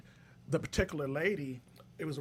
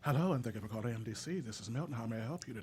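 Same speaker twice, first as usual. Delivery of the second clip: polished customer service voice; telephone voice; 4 semitones lower, 7 dB quieter, faster than usual. Pretend. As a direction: answer the phone all professional. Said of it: "give me telephone voice".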